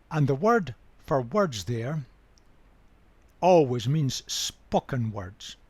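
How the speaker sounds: normal talking voice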